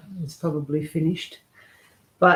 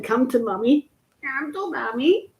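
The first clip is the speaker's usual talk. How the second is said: high, coaxing mummy-to-cat voice; pet talk; 7 semitones higher than usual. Real, not acted. Owner calling her cat to come to Mummy, high pet talk. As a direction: coo it high, rising at the end.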